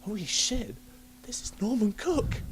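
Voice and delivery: realising the stranger is a famous DJ, rising excitement; starstruck disbelief. Retold or imitated. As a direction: gasp it out, star-struck. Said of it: "I'm like".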